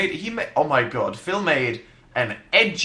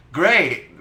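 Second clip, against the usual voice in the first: usual speaking voice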